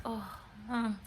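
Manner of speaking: breathy unvoiced "Oh, hmm"; a hushed little hmm; whisper. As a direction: whisper it, quietly impressed. Woman reacting to big jobs.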